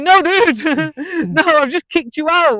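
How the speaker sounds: high, loud, laughing refusal at the customer; laugh-shout it in disbelief; exasperated laughing yell. Retold or imitated. Bartender refusing the drunk customer's taxi money, laughing yell. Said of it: "I'm like".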